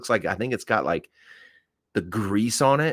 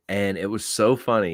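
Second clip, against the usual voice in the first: usual speaking voice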